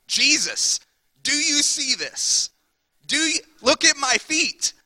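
put-on a thrilled kid shouting look at me; amazed excitement. Peter amazed, calling to Jesus.

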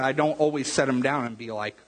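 normal talking voice

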